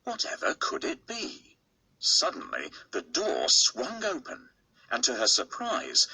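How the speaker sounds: usual speaking voice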